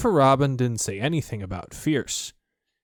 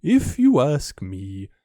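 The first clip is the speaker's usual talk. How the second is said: very slow, ponderous owl voice; 2 semitones higher, 4 dB louder, much slower than usual. Acted. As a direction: weigh each word like a wise old bird. Owl's slow pompous voice.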